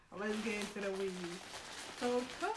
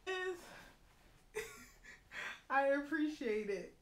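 normal talking voice

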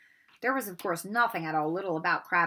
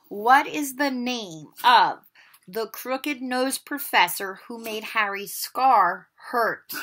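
slow; 2 semitones higher, 6 dB louder, slower than usual. Shown as a demonstration.

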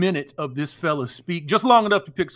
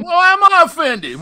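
high, loud mock indignation; hammed-up, high-pitched protest; mock offended; 13 semitones higher, 8 dB louder than usual. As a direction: squawk it in put-on offence. Co-host playing at taking offence.

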